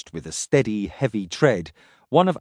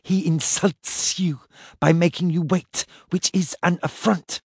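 hissing serpent dragon; 5 semitones higher than usual. Acted. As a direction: draw out every s like a serpent. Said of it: "in her sibilant voice".

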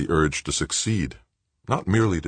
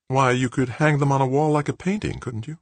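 high-pitched character voice with a questioning lilt; high, wondering board member; woman board member; 10 semitones higher than usual. A narrator's reading. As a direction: pipe up in a much higher voice. Board member reacts to the screens.

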